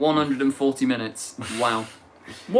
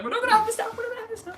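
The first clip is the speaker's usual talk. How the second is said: squeaky mock scold; 4 dB quieter, much faster than usual. Shrill mock scolding, laughing.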